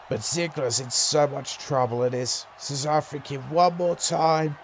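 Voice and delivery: ordinary talk, nothing marked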